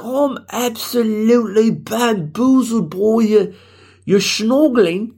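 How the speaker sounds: high, slow, hammed-up British voice; mock British accent. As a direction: play at fitting in with an exaggerated British accent. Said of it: "I would fit in, though".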